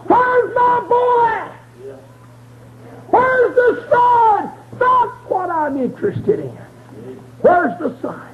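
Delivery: high, slow crying shout; father crying out. Acted. Old father calling for his son, high crying shout. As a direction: cry out for the lost son.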